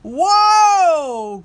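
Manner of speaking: a high, loud startled whoa; loud cry. Acted. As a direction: cry out as you fall into the mud. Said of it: "cried Old Tom".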